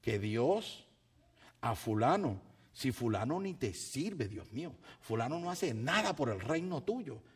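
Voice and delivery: usual speaking voice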